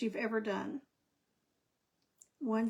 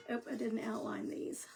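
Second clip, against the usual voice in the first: ordinary talk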